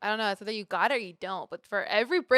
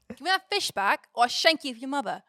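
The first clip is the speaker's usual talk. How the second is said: loud, higher mock English accent; 5 semitones higher, 7 dB louder than usual. Shown as a demonstration. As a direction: threaten loudly in a put-on English accent. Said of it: "tapped into your English accent".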